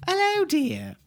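very high, slow granny voice; old-lady falsetto. Pretend. Host welcoming listeners back as an elderly nanny. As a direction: draw it out like a sweet old nanny.

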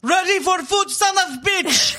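high, loud dinner call; calling out loudly. Retold or imitated.

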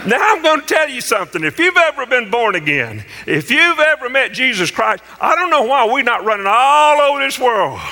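high, impassioned pulpit delivery; fervent preaching. Real, not acted. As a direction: drive it home to the congregation. Preaches at the congregation.

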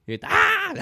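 high, loud laughing squeal. Real, not acted. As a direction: shriek and burst out laughing.

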